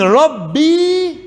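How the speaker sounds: Rabbi said slowly with the final i held long; long vowel. Shown as a demonstration. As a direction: stretch out the last vowel of Rabbi. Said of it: "en longue voyelle".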